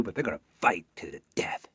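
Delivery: ordinary delivery, nothing marked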